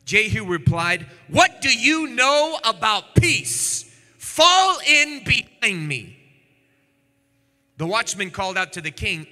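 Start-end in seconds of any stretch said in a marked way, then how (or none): 1.3-6.2 defiant. Acted. Jehu defiant reply in story.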